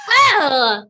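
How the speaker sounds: high, loud "what'd you say?"; mock outrage at a bad word; mock shocked shout. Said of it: "I'm like".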